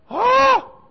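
a high, loud startled oh; crowd's gasp. Retold or imitated. Preacher imitating the crowd seeing a miracle, high startled oh. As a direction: gasp like you've seen a ghost. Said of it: "everybody was like".

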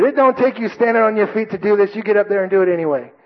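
high-pitched hectoring sergeant; drill sergeant. Retold or imitated. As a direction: imitate a sergeant ordering a recruit up. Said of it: "the drill sergeant looks at me".

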